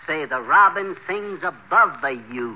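"the robin sings above the you" said slowly, each word spelled out; over-enunciated. Shown as a demonstration. Coach showing his pupil how to enunciate the line, slowly. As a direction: lay out every word distinctly, slowly. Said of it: "you don't enunciate distinct".

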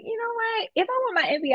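high-pitched self-quote of making up one's mind; retold decision. Full ride student recalls skipping the fifth year, retold decision. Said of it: "So I was like".

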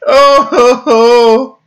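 high squealing laugh. Real, not acted. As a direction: shriek it with laughter. Reacting to outrageous suggestion.

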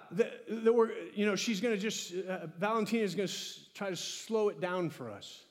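ordinary talk

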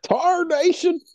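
high, loud Texan yokel voice. Pretend. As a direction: crow it like a cartoon cowboy. Joking Texas banter.

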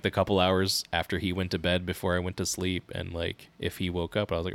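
ordinary delivery

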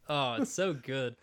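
ordinary talk, nothing marked

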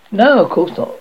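plain reading voice

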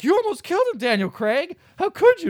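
high-pitched mock outrage; mock upset. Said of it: "Katie is very upset".

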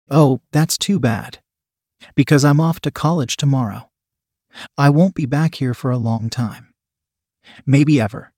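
plain reading voice

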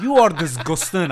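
put-on loud laughing Glasgow accent. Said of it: "I'm saying it in a Glasgow accent".

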